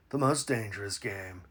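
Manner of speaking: low, soft sinister hush; ominous low hush. Acted. Comedian turns a letter line into a creepy hunter's threat. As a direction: menace the words quietly.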